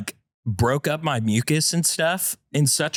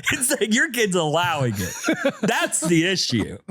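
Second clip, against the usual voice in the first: laughing while talking; 10 semitones higher than usual. Real, not acted. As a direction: giggle through the words. Laughing through his joke.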